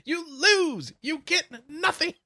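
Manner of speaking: high, loud furious shouting; Willy Wonka rant. Pretend. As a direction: rant like a furious candy maker. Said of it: "the Willy Wonka speech".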